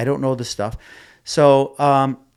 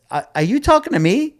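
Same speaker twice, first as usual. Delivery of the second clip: much higher, startled; stunned question; 11 semitones higher than usual. Retold or imitated. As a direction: caught off guard, are you serious. Own stunned reply.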